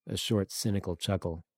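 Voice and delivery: ordinary delivery, nothing marked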